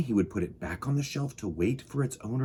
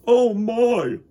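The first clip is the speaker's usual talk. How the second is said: higher, louder fussy exclamation; Mister Glum; 11 semitones higher, 8 dB louder than usual. Acted. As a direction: complain loudly over the noise. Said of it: "said Mister Glum".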